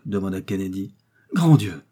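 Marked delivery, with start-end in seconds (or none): 1.3-1.8 loud startled exclamation; sudden loud outburst; alarmed cry. A narrator's reading. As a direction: cry out in shock, voice falling. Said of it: "s'écria Joey".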